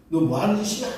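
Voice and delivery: a startled husband snapping at his wife; angry shout. Retold or imitated. Husband yelling at wife rubbing his legs, angry. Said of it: "큰 소리를 쳤습니다".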